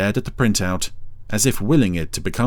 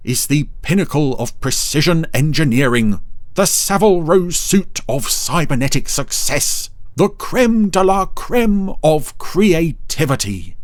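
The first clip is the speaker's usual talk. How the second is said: grandly huffy, higher and louder; 8 semitones higher, 4 dB louder than usual. A narrator's reading. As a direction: boast it with wounded pride. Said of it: "said Dougal huffily".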